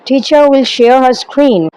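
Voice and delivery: slow, measured English read from a script. Acted. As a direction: read each phrase out slowly like a formal guideline. Reads training script slowly in English.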